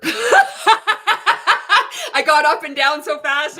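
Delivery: high, loud words bubbling over with real laughter; laughing hard through the words. Real, not acted. Laughing as she tells chat about her burp.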